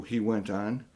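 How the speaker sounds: usual speaking voice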